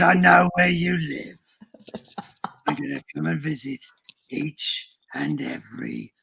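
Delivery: slow, creepy breathy whisper; heavy breathing phone whisper. Acted. Puppet threatens viewers, unvoiced.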